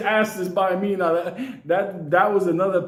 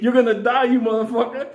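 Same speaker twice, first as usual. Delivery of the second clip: loud, high mock-rage yell; retold furious threat; 7 semitones higher, 4 dB louder than usual. Driver pounding the baboon to the front, mock-furious yell. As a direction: yell it as if mid-fight.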